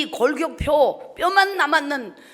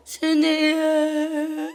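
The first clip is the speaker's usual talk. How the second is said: slow, tearful calling of the name; mother crying; 4 semitones higher, 5 dB louder, much slower than usual. Retold or imitated. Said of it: "하면서 엄마가 울어". (우는 엄마 목소리로 이름 부르기)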